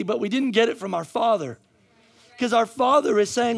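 high, emphatic preaching in repeated waves; full-force preaching. Real, not acted. Preacher challenging the congregation's self-belief, full-force preaching. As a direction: drive it home line after line.

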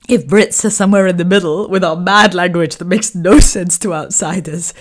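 ordinary delivery

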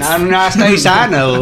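mocking echo. Mocking repeat of friend.

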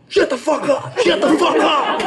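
imitating a lawyer snapping at his client; mock furious yell. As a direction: bark it at her like a boss losing it. Lawyer suddenly yells at his client.

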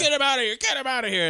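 high, shouted order; urgent shouting. Retold or imitated. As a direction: yell it to the guards. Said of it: "I'm like".

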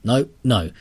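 usual speaking voice

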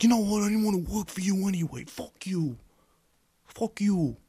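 hammed-up fury at the boss; pretend angry comeback. Pretending to tell the boss off after being turned down, mock furious. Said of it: "That's me".

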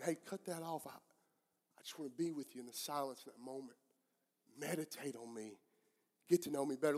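soft, low voice of God; quiet divine invitation. Acted. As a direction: draw them gently into the silence. God invites listener into silence.

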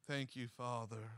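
low, hushed thanks to God; quiet prayer. Real, not acted. Preacher pausing to thank God, quiet prayer. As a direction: drop down low and soft, as if praying privately.

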